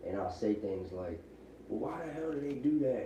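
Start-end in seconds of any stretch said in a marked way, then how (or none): 1.7-3.1 mock indignant question, pitched up; exasperated retold complaint. Father griping to wife about the kids, mildly exasperated.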